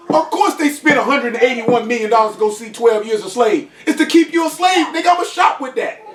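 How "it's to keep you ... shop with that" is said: heated rant. Real, not acted.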